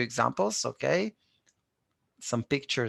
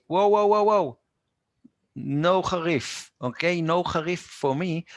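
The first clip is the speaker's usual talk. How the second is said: mock customer protest; 5 semitones higher than usual. A customer at the falafel place refusing spicy food, mock alarm. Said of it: "and you want to say".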